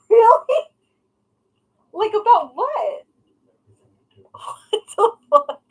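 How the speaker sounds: high, laughing, slow; laughing disbelief. Real, not acted. Vlogger laughing at husband's answer, high and laughing. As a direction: laugh it out incredulously. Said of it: "laughing disbelief".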